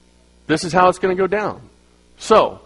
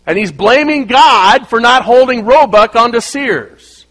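louder, higher, slow and indignant; loud comic punchline; 7 semitones higher, 8 dB louder than usual. Real, not acted. Preacher telling the congregation his bike story, loud and indignant. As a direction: land the joke with mock outrage.

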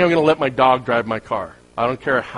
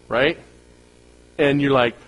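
ordinary delivery

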